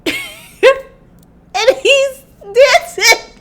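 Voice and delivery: very high, drawn-out, strangled by laughter; real helpless laughter squeezing the voice; laughing to tears. Real, not acted. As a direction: barely get the words out for laughing. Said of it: "Y'all must start crying".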